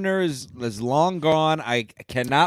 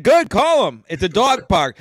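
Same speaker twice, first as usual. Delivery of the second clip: louder, higher mock retort; defiant retort; 6 semitones higher, 6 dB louder than usual. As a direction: dare her to call. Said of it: "I said".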